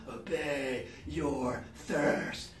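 slow, soft mock commercial voice; ad slogan. Mocking the world's thinking in a soda ad voice. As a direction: purr it like a soda ad. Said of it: "the world and its system".

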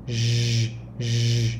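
z with the throat buzzing; voiced z. Shown as a demonstration. Teacher modelling the voiced z for learners. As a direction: buzz the z from the throat. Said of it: "cổ họng rung lên sẽ là z".

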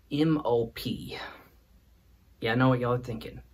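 ordinary talk, nothing marked

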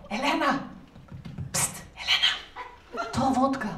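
soft, unvoiced conspiratorial psst; whispering through the letterbox. Svetlana calling quietly through the letterbox, whispered. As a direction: hiss it secretly through the mail slot. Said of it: "ropade hon i brevinkastet".

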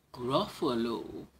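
usual speaking voice

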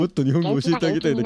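creepy viewer mimicry. Streamer mocking a creepy viewer comment in a creepy voice. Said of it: "気持ち悪いな".

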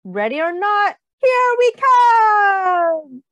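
loud, high, drawn-out call; playful shout. Acted. Reader to children, loud slow playful call. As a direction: stretch it out like a game of hide and seek. Big fish about to splash.

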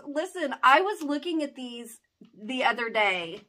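ordinary talk, nothing marked

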